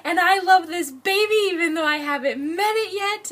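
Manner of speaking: high, loud gushing imitation of a thrilled grandmother; hammed-up delighted squeal of words; excited grandma. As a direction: act out a grandma bursting with joy. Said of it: "she was so excited".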